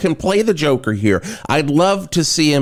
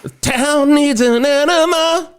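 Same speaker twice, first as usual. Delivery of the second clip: high, slower put-on Joker voice; Joker impression; 14 semitones higher than usual. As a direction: echo the line as the Joker, drawn out. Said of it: "that song where it's like".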